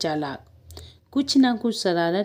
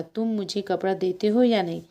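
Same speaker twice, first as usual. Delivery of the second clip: normal talking voice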